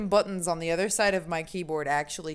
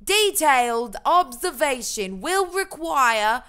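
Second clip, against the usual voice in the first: hammed-up British-Australian accent, pitched higher; terrible Australian-British accent; 4 semitones higher, 4 dB louder than usual. Streamer reading about the conjunction, terrible Australian-British accent. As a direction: proclaim it in a badly faked British accent. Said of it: "half terrible Australian, half terrible British".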